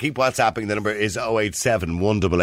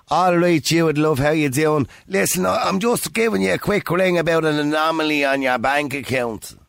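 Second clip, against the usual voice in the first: higher-pitched, put-on strong Irish accent; mock official caller; 7 semitones higher than usual. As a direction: play a bank caller with a thick local accent. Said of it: "I called you and went".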